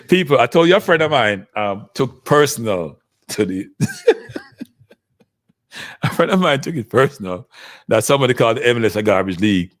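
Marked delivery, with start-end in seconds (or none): 3.7-4.4 a very high squeal of laughter; can't hold back a squeaky giggle. Real, not acted. Laughs mid-story about friend.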